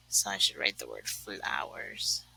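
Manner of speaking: whispering. Real, not acted. Whispered aside to herself.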